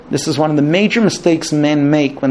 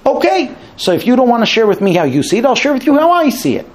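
higher, exasperated husband laying out his side; a husband giving up on patience, pitched up high; frustrated husband; 8 semitones higher than usual. Retold or imitated. Husband tired of waiting tells his wife his view, exasperated. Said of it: "he's gonna get frustrated".

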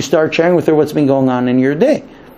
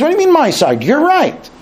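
higher, innocent wife voice; wife's reply; 12 semitones higher than usual. Retold or imitated. Wife answering her frustrated husband, innocent. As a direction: play the wife shrugging off the argument. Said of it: "She says".

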